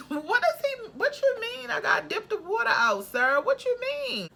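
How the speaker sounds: can't believe what he just told you; incredulous disbelief. Retold or imitated. Told to dip the drain water out, shrill high disbelief. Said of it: "And I was like".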